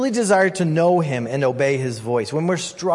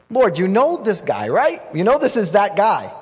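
higher, pointed insistence; exasperated Ananias; 4 semitones higher, 3 dB louder than usual. Acted. Ananias pressing God about Saul, exasperated. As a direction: make sure God knows who this is. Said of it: "get it off his chest".

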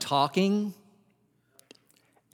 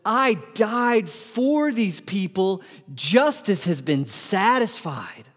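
slow, lightly voiced Jesus' blood; slow, calm voice of Jesus' blood declaring justice done; 4 semitones higher, slower than usual. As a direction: speak it slowly as the blood that saves. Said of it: "Jesus' blood says".

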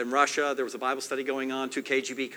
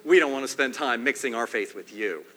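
forceful soldier explanation; 5 semitones higher, 6 dB louder than usual. Retold or imitated. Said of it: "they said".

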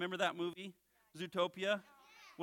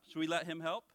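ordinary talk, nothing marked

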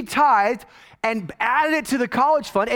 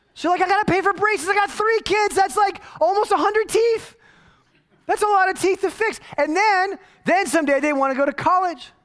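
exasperated high rant; 10 semitones higher than usual. Real, not acted. Complaining about paying for braces.

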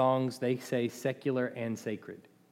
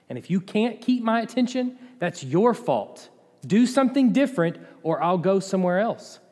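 mock churchgoer's ultimatum, raised and louder; demanding complaint; 7 semitones higher, 6 dB louder than usual. Churchgoer blaming the preacher for lost attention, mock demanding. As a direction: push the blame onto the listener, playing indignant.

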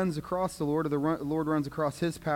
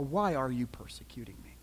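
whispered voice of God; 4 semitones lower, 5 dB quieter than usual. Acted. Voice from heaven speaks to Saul.

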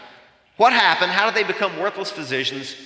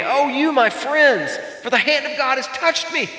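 crying out; 9 semitones higher than usual. Retold or imitated. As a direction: cry out to friends in anguish. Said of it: "Job is just weeping and he's crying out".

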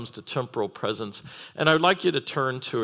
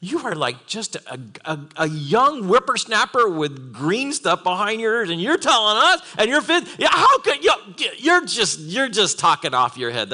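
rising into a mock indignant shout; scoffing Pharisees; 6 semitones higher, 4 dB louder than usual. Pharisees scoffing at a young Jesus, mock outrage building to a shout. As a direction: scoff at the upstart, building to a yell. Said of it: "So he's going".

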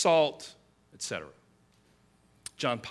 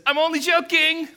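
higher, louder jokey protest; mock excuse; 12 semitones higher, 8 dB louder than usual. Deceiver excusing himself to his neighbor, hammed-up jokey shout. Quoting the deceiving madman, acted.

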